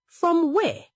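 high-pitched, dismissive question; mother scoffing at the request, pitched sharply up; dry scorn. A narrator's reading. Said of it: "Egodi laughed in a dry way".

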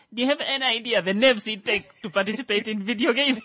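high and loud, fired up; excited. Real, not acted. Excited rant about gamers.

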